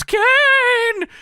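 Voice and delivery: long, very high falsetto yell; Paul Bearer. Acted. Wrestling manager yells to Kane from afar, high wail. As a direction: call out in a squealing mock wail. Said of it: "Paul Bearer yelling from the distance".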